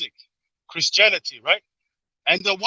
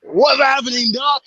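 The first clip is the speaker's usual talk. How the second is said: hammed-up Southern US greeting, higher and louder; mock Southern accent; 6 semitones higher, 7 dB louder, slower than usual. UK guest answers the host's request with a Southern accent. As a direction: put on a Southern drawl for a friend. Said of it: "do your best Southern accent".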